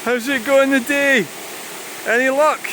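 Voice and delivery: high, slow sing-song pet talk; cooing high at a wild bird on the river. Real, not acted. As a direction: coo at the heron like a pet. Greeting a heron on the riverbank.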